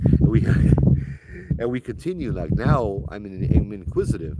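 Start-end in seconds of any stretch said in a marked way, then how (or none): 0.5-1.5 short, loud burst of laughter. Real, not acted. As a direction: burst out laughing mid-story. Laughs at own chicken story.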